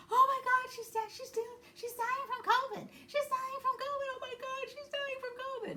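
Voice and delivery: very high, frantic shriek of alarm; panicked caller. Retold or imitated. Someone on the call panicking that she is dying, very high frantic shriek. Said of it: "And I heard".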